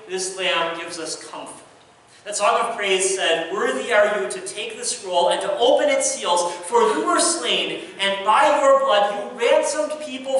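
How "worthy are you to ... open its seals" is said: ordinary talk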